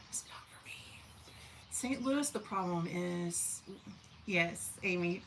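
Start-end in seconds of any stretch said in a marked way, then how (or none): 0.1-1.0 unvoiced little whisper; whispered aside. Telling viewers about Texas heat, a whispered aside. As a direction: drop to a whisper for the punchline. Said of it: "And I was like".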